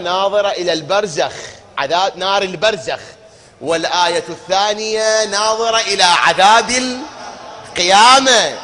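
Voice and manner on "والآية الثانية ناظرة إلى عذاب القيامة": fiery sermon climax; rising preaching shout. Real, not acted. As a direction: drive the point home louder and louder.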